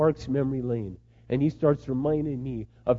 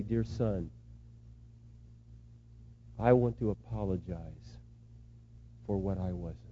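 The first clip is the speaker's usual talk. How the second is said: low, soft, contrite reading; subdued apology; 6 semitones lower, 10 dB quieter than usual. Acted. Father apologizing to his son by letter, low and subdued. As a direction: lower the voice and let each phrase settle.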